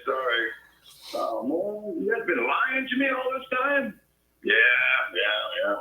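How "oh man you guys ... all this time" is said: high-pitched mock whine of a let-down child; betrayed kid voice. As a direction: whine like a child who was lied to.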